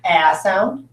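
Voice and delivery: "a" said as short A, as in cat; short A sound. Shown as a demonstration. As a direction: say "a" as the short vowel in match. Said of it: "Is it making an a sound".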